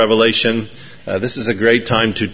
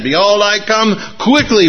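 higher-pitched, hammed-up proclamation; more oomph; 9 semitones higher than usual. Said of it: "with more oomph".

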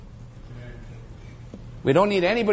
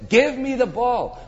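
insistent demand; 6 semitones higher than usual. Acted. Preacher voicing star athlete wanting to score, insistent demand.

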